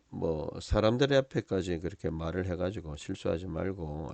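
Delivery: ordinary talk, nothing marked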